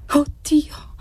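pained, restrained plea; anguished. A narrator's reading. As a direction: cry out in distress without raising the volume. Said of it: "esclamò la voce angosciata".

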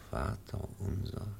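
hushed prayer at the deathbed; whispered prayer. Acted. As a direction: pray softly over the dying boy. Wir wollen beten.

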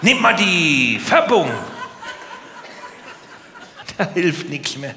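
normal talking voice

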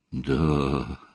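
low, soft, drawn-out words; bewildered drawl. A narrator's reading. Kostya takes in the bad news from Olga, bewildered drawl. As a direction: draw it out, quietly at a loss. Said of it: "протянул Костя, растерянно".

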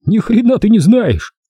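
high hissing lisp; hissed. Acted. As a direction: hiss it up high through the teeth. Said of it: "прошипел Томми".

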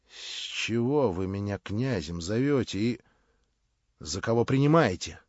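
plain reading voice

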